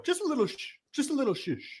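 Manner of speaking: soft, high-pitched polite shush; dainty, hushed high voice; mimicked shushing waiter. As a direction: play a sitcom waiter delicately hushing a diner. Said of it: "that's how it would go in the show".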